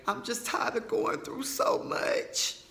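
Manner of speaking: high, breathy mock wail of a crying mother; hammed-up tearful whine, high and slow; imitating his mom's tearful self-pity, overdone. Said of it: "she just started crying".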